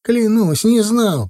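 high, frantic denial; desperate oath. A narrator's reading. Titok swears innocence to Zakhar.

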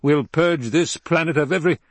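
loud, high urgent order; rally them into the ship; urgent command. A narrator's reading. Emperor shouting his people into the ship.

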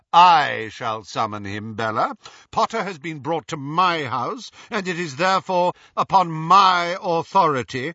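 loud, rising assertion of rank; pompous insistence. A narrator's reading. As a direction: build in pitch, pulling rank. Lucius quarrels with Bellatrix over authority.

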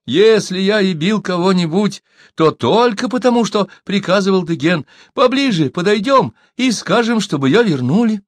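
high-pitched, eager excuses; storyteller voicing a wheedling coward; ingratiating. A narrator's reading. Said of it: "говорил Сибун".

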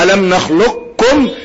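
slow "نخلقكم" with qaf gone, doubled kaf; complete idgham. Shown as a demonstration. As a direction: say "نخلقكم" as nakhluk-kum. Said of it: "الإدغام الكامل اللي هو".

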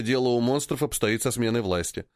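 usual speaking voice

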